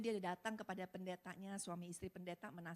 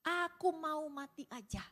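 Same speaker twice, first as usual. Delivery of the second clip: despairing husband; 7 semitones higher, slower than usual. Retold or imitated. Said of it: "Suaminya teriak-teriak sambil bilang".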